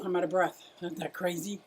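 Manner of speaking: normal talking voice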